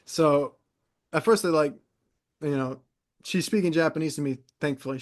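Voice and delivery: normal talking voice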